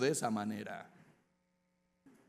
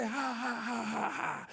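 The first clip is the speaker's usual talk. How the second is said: mock laughter; 7 semitones higher, 5 dB quieter, much slower than usual. Philistine army mocking David.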